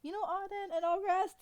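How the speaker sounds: put-on high, loud little-girl voice; high-pitched excited goblin kid; Kara. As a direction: ask in childlike amazement. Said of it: "says little Kara".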